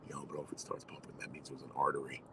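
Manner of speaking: hushed, voiceless whisper into an ear. Retold or imitated. Friend warns the bleeding boy in his ear, whispering. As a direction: drop to a secretive whisper. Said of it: "he's whispering in my ear".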